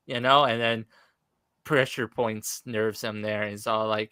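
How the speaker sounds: ordinary delivery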